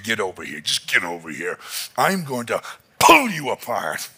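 usual speaking voice

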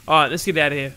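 ordinary talk

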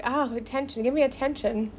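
small, soft mocking whine for attention; mocking attention-seeker. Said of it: "she's all like".

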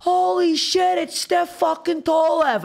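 high, slow, starstruck squeal; excited fan shriek. Retold or imitated. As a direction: squeal like a drunk fan spotting a celebrity. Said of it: "this woman's like".

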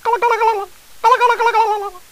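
very high fast warbled gullah gullah; turkey gobble. Shown as a demonstration. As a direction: gobble like a turkey, throat shaking. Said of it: "massage your Adam's apple with your finger as you say it".

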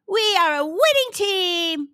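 high, loud cheer from a team; gleeful howl. A narrator's reading. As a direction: howl it out in triumph. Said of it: "they howled".

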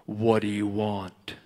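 very low, slow, solemn God voice; voice from the throne. Retold or imitated. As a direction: intone it deep and drawn out. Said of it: "a voice came off the throne".

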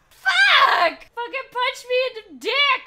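high, loud shouted curse; startled swearing. Real, not acted. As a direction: curse loudly after a fright. Reacting to scare.

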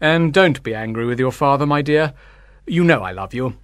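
low, tender paternal voice; gentle father. A narrator's reading. Father soothing his younger daughter, gentle. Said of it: "he said gently".

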